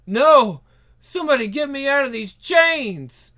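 high, urgent cry for release; screaming for help. Acted. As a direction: cry out desperately to be freed. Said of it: "I'm not screaming about you".

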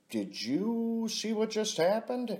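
stunned, wide-eyed ask; astonished question. Retold or imitated. To Widow back at the table, high astonished disbelief. Telling Widow about the strange encounter.

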